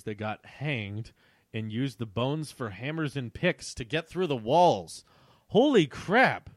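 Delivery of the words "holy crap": slow, loud, higher gasp of shock. Real, not acted. Reader shocked by the dark plot, loud slow gasp. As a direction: drag it out in horror.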